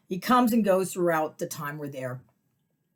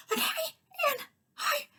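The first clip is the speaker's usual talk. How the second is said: high squealing fangirl hello; starstruck squeal; 3 dB quieter than usual. Retold or imitated. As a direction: gush like meeting your idol. Greeting a TV host, starstruck.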